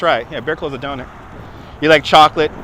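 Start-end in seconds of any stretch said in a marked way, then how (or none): 0.3-1.1 much softer, half to himself; quiet repeat. Real, not acted. Streamer repeating a chat answer, much softer. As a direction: murmur it back under your breath.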